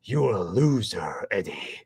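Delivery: slow, taunting monster voice; mock your host, drawing it out as the creature; Venom. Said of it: "that's one of my favorite lines".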